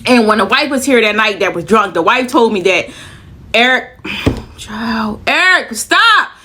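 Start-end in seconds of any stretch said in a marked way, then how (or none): 3.5-6.3 playfully warning a friend to stop; mock scolding. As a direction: drag out a teasing stop. Scolds absent friend Eric, teasing.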